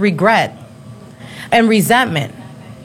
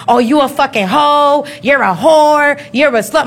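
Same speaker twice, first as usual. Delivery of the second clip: mock angry ex; 3 semitones higher than usual. Baby daddy insulting her, mock angry. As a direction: throw the insults like the jealous ex. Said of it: "had I stayed with my baby daddy".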